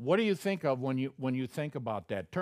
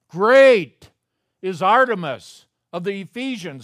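"Great" bellowed far louder and higher; crowd shouting; 7 semitones higher, 15 dB louder than usual. Retold or imitated. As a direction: roar it like a rioting crowd. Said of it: "people were crying out".

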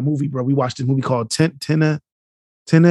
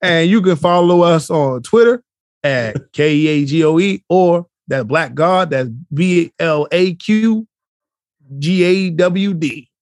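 podcast host outro; 3 semitones higher, 4 dB louder, slower than usual. Shown as a demonstration. Signing off the show to listeners, announcer voice. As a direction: announce the hosts and handles with flair. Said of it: "close this out".

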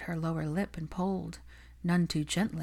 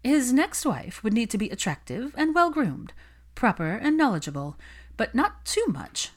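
higher, self-satisfied gossip voice; smug socialite; 5 semitones higher than usual. Acted. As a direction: tick off the virtues she thinks she has. Olivia lists wifely virtues.

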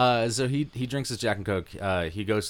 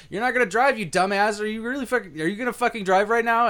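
high, raised scolding; mock scolding; 11 semitones higher than usual. Bartender scolding the drunk who wants to drive. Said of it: "I'm like".

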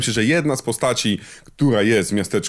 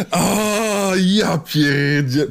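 stunned gasp; 7 semitones higher, much slower than usual. Real, not acted. Co-host gets the pun.

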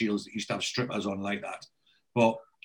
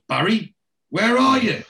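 raised, high-pitched call of a name; acted phone shout; 9 semitones higher, 6 dB louder than usual. [name] to Barry on the phone, mock exasperated shout. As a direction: call out loudly down the line.

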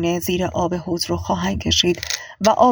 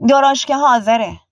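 calling out; 6 semitones higher, 5 dB louder than usual. A narrator's reading. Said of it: "صدایی گفت".

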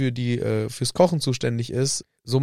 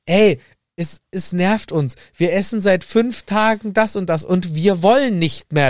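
whiny complaint; 6 semitones higher, 3 dB louder than usual. Retold or imitated. Whiny complaint to camp cook.

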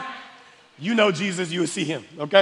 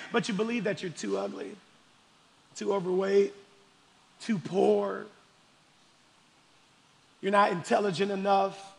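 normal talking voice

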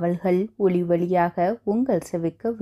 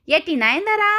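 calling out; 6 semitones higher than usual. A narrator's reading. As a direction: call her name from afar. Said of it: "ஒரு குரல் கொடுத்தார்".

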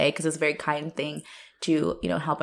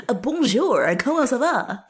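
higher, louder mock sexy French voice; put-on sexy voice; 6 semitones higher, 4 dB louder than usual. Friend imitates Arthur's sexy French voice, hammed-up. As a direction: imitate his put-on seductive French, laughing through it. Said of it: "sexy voice that he puts on".

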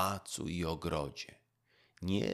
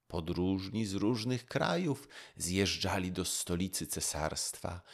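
plain reading voice

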